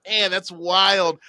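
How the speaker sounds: man that's wild stretched out in mock awe; slow amazed reaction. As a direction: savor the surprise slowly. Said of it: "I'm like".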